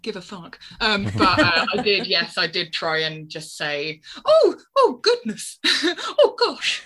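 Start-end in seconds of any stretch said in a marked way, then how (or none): 4.2-6.8 high, dainty oohs of polite shock; prim mock exclamations. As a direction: swap swearing for squeaky-clean dismay, half laughing. Acting out careful swear substitutes.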